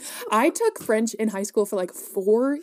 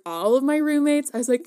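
ordinary delivery

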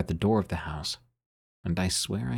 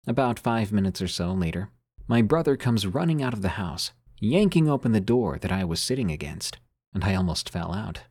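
plain reading voice

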